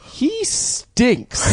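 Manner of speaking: put-on high, drawn-out childish complaint; blunt little kid. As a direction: announce it to Grandma in a small child's voice. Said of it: "turning to my grandma and being like".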